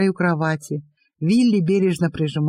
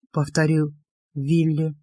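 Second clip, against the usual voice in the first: plain reading voice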